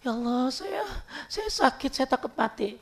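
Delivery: soft, trembling plea with a high break on saya; quiet fearful voice of a sick man; frightened dying man. Retold or imitated. Dying man confesses his fear of death to a visitor, soft and afraid. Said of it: "Ada orang dulu tuh mau takut".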